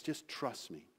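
soft, gentle voice of Jesus; humble reassurance. Acted. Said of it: "Jesus humbly comes to us and says".

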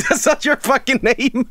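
cracking up at the clip; laughing. Real, not acted. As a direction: laugh it out in disbelief. Laughing at the ASMR video.

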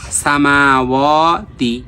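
সামাওয়াতি with মা and ওয়া drawn out, তি short; elongated recitation. Shown as a demonstration. Teacher modelling madd for students, slow drawn-out সামাওয়াতি. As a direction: stretch both long vowels in সামাওয়াতি slowly. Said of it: "মাদের হরকত এক আলিফ".

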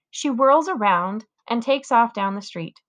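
usual speaking voice

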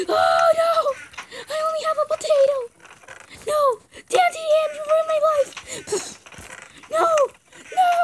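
high, laughing mock wail; mock despair. Gamer wailing at her game character over having only a potato. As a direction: ham up the tragedy, giggling.

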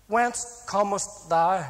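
slow, halting reading of archaic English; puzzled reading aloud. Retold or imitated. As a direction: pick through the old words one by one. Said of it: "this old English is amazing".

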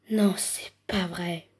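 slow whispered disbelief; stunned whisper. Pretend. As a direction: breathe it in disbelief. Stunned by a gift.

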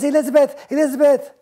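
a hint of a fussy prince; haughty prince. Prince Ronald scolding Elizabeth, snooty and high.

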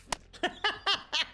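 high, rapid giggling burst; high laugh. Real, not acted. Laughs at opponent.

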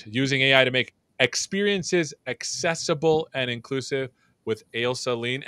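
fast, bright show welcome; host opening. Acted. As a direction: open the show with energy.

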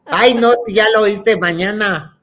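high, loud protesting refusal; exasperated mother. Retold or imitated. Imitating mom refusing to replay the story, exasperated. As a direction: protest like a worn-out parent.

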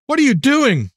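high-pitched yell without much volume; mock yell. A narrator's reading. Daughter startled awake by her father, high yelp of protest. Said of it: "she yelled".

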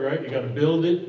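ordinary talk, nothing marked

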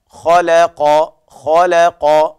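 خلق very slowly, heavy خ and ق around a light ل; ترقيق اللام بين مفخمين; tajweed demo. Shown as a demonstration. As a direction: isolate خلق slowly, thin ل between heavy letters. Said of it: "اللام هنا وقعت بين الخاء والقاف".

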